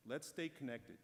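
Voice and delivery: ordinary talk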